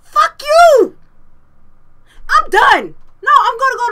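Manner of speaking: loud, high-pitched angry outburst; real furious shout. Real, not acted. Gamer raging at a broken game, furious shout. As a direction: yell it, completely fed up. Game cuts out, player rages.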